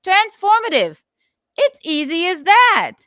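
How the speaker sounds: loud and high, proud of the trick; excited shout. Real, not acted. Streamer loudly showing viewers how easy it is, excited shout. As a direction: announce it like a triumphant reveal. Mock triumphant explanation.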